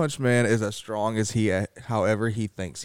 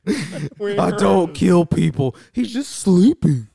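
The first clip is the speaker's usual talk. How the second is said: slow, loud superhero parody voice; hammed-up hero excusing himself, pitched up; Batman; 8 semitones higher, 6 dB louder, much slower than usual. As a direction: drag it out in a mock vigilante voice. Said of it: "I like the Bat Metal voice".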